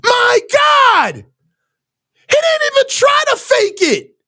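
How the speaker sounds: high-pitched outraged yelling at the screen. Real, not acted. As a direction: yell at the TV in disbelief at the throw. Fan raging at interception on TV.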